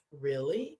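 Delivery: low, questioning retold reply; understated query. Friend asking about Knappers in New Orleans, understated query. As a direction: ask it low, with rising tune.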